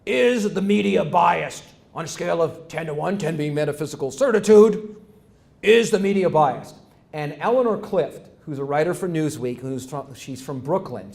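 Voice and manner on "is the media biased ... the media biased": a hint of a TV host, booming the question; McLaughlin impression. Lecturer retelling McLaughlin's question to the panel, lightly voiced. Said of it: "McLaughlin says".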